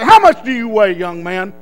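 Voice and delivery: loud opening, dropping at the end; officer questioning recruit. Retold or imitated. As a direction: demand it like an officer. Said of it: "the next guy'd come up there and say".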